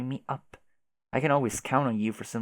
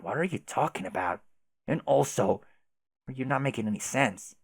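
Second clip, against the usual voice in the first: ordinary talk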